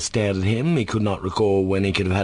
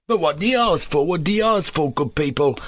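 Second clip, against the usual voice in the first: high-pitched, desperate appeal; near desperation; 8 semitones higher than usual. A narrator's reading. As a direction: cry out bewildered, begging the bystanders. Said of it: "he cried, almost in desperation".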